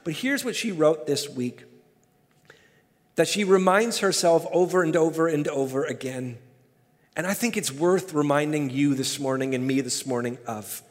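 ordinary talk

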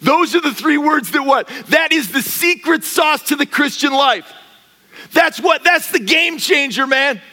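loud, high preaching over music; full-force preaching. Acted. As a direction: build the fire, loud and high.

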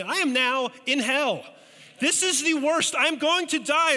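put-on rising high, loud child panic; a terrified eight-year-old sure he'll die; panicked thought. Kid in a dark cave panicking, loud and high. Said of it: "and I'm thinking".

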